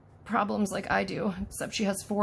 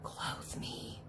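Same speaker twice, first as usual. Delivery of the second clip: mock doll whisper; 12 dB quieter than usual. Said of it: "She's like".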